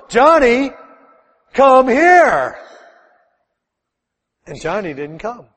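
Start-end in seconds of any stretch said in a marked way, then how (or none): 0.1-2.6 put-on louder, higher call to a child; raised voice calling. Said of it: "So she raises her voice a little more".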